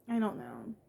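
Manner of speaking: soft, near tears; quiet, fighting back tears; tearful, quiet. Real, not acted. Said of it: "I'm trying not to cry".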